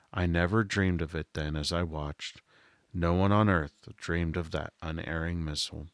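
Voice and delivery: ordinary delivery, nothing marked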